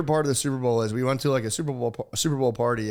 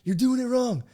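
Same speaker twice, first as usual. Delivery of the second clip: put-on high, bossy kid voice; imitating a bossy little girl; 11 semitones higher than usual. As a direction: lecture him like a know-it-all kid. First telling, high kid voice.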